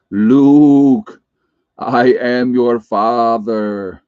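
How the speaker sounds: slow, booming villain voice into the fan; Darth Vader. Pretend. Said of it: "Sorry, I had to do that".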